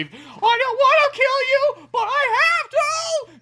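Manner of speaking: high, slow squeaky toad plea; squeaky falsetto. Pretend. Toad pleading while attacking, high squeaky voice. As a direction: whine it in a high cartoon voice.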